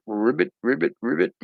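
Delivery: lightly voiced croaking frog, rising on the last; playful mock frog call, ribbit by ribbit. Drawing teacher voicing his cartoon frog for kids, light croak. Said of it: "He's like".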